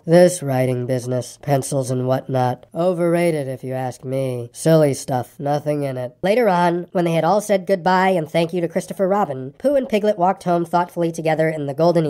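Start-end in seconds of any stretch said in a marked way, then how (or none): 0.0-6.1 low, slow sour grumble; grumbling to himself. A narrator's reading. Eeyore grumbling about pencils, low sour grumble. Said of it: "saying to himself".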